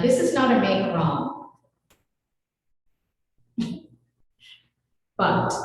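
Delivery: normal talking voice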